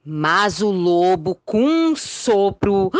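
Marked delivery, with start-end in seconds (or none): none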